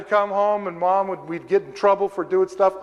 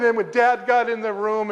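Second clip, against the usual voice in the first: ordinary talk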